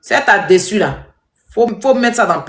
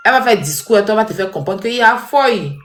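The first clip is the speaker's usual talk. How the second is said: ordinary talk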